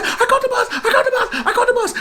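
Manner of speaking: very high, giddy laughing shouts; excited kid. Retold or imitated. Billy catching the bus, high giddy cheering. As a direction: squeal with delight at your own feat.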